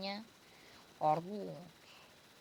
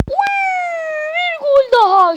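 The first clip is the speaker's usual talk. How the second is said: screaming football commentator; 16 dB louder than usual. Acted. Goal scored.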